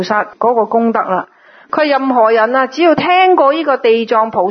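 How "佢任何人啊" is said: ordinary talk, nothing marked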